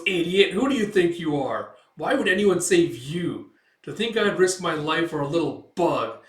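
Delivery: acted contemptuous scolding; scornful rebuke. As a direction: jeer at the very idea.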